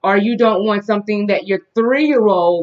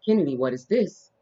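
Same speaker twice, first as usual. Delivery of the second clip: put-on reading to a toddler; 3 semitones lower, 6 dB quieter, slower than usual. Teaching toddler shapes.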